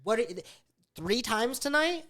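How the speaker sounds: high, loud exasperated shouting; fed up after the third wake-up, let it out; angry shouting. Retold or imitated. Waking for the third time to her hitting him, shouting angrily. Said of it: "I got mad".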